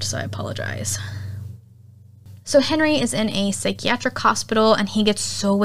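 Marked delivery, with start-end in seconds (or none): 0.0-1.0 sheepish whispered aside; hushed sorry; whispered apology. Real, not acted. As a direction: breathe the apology out, embarrassed. Sheepish aside after nerdy tangent.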